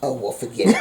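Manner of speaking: ordinary talk, nothing marked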